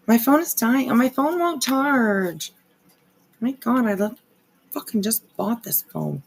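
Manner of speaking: real frustration, pitch up, pushing through it; getting a little angry. Real, not acted. Streamer venting to chat that her new phone won't charge, real irritation. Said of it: "I'm getting a little angry".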